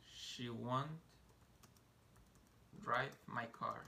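"drive my car" spoken very slowly; patient teacher pacing; slow model. Shown as a demonstration. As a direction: stretch out "drive my car" for learners. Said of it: "teacher models English slowly".

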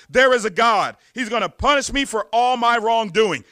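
a loud, anguished mock cry of confession. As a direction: call it out like a dying man afraid of punishment. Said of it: "he cried out".

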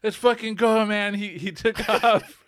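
hyped and half laughing, slow on the last words; excited retold urging. Friend retells urging him to chase the drunk driver, excited and laughing.

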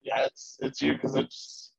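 glitchy robotic audio; choppy digital voice. Real, not acted. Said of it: "you sound like a robot right now".

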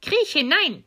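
high, loud witch order; commanding witch. Acted. Witch sending Gretel into the oven, loud command. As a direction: order her in forcefully. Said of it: "sagte die Hexe".

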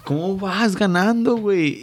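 high-pitched disbelief; exasperated. Real, not acted. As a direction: rant in frustrated disbelief. Frustrated rant about lost lead.

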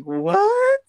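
very high, loud, drawn-out "what"; hammed-up falsetto shock; shocked squeal. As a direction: squeal it in mock disbelief. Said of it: "You're like".

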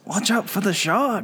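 high, hammed-up Irish-or-Scottish warning; announcer's Irish-or-Scottish accent. Cohost joining the announcer impression, high mock Irish-or-Scottish accent. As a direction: call it out as a mock Celtic announcer. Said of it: "like, Irish or Scottish".

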